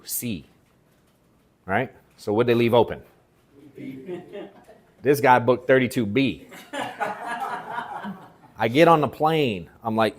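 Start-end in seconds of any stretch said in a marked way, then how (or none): 3.8-4.4 laugh. Real, not acted. Laughing at own seat trick. 6.7-8.1 laugh. Real, not acted. Laughing at own seat trick.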